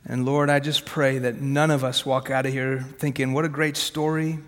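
ordinary delivery

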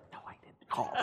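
imitating an urgent unvoiced whisper; mock whispered protest; whispered denial. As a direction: whisper it back as a private aside. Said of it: "whispers who it was".

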